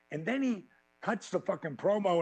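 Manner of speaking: usual speaking voice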